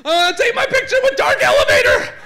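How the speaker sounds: put-on very high-pitched child's squeal; frantic excited kids. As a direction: shriek and beg like overexcited children. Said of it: "jump up and they're like".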